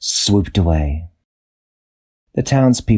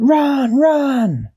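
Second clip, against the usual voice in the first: yelling. A narrator's reading. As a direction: scream at everyone to flee. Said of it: "Everybody yelled".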